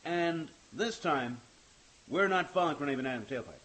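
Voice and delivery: big deliberate pauses, emphatic phrase by phrase; Will Shatner. Acted. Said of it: "If I was doing Will Shatner".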